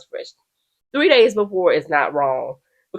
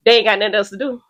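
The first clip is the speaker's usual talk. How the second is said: ordinary talk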